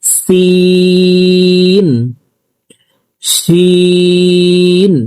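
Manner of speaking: each letter held slow and sung; recited letters. Shown as a demonstration. Teacher repeats the Arabic letters for students, slow chant. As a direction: recite the letters one by one, drawn out. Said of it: "আমি এখান থেকে আবার বলছি".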